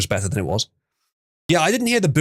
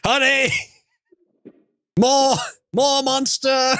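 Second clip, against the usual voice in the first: calling out; 13 semitones higher, slower than usual. Real, not acted. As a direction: shout for someone in another room. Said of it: "Just scream like a normal man".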